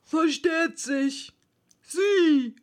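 slow, high, emphatic pronouncement; Dodo. A narrator's reading. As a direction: declare it slowly as the one in charge. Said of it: "sagte der Dodo".

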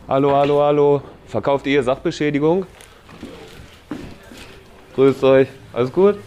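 muffled through a face mask. Real, not acted. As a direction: speak through a respirator mask. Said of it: "Klingt man immer ein bisschen anders".

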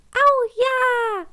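hoch piepsend jubeln; jubelndes Küken. Acted. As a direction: freudiger Kükenjubel. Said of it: "jubelte Clara". (jubelndes Küken)

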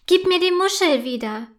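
viel höher und lauter, kindlich zeternd; schimpfend. A narrator's reading. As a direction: die Muschel lautstark zurückverlangen. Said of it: "schimpft Sophie". (Sophie schimpft mit Clara, hoch und laut)

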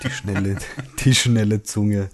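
loud laughing mock threat; laughing tease. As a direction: laugh through a playful jab. Teasing about his quick tongue.

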